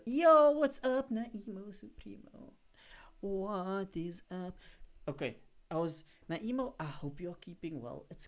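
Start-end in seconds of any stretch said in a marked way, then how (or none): 0.0-4.6 loud, high, drawn-out shout-out; hyped greeting. Real, not acted. Streamer greets Naimo arriving in chat, hyped greeting. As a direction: stretch the welcome out slow and big.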